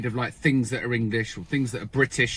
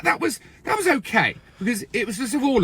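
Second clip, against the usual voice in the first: much higher, building outrage; incredulous rant; 7 semitones higher than usual. Real, not acted. Brit ranting to a reacting viewer, high and incredulous. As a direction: rant in disbelief. Ranting about duped nations.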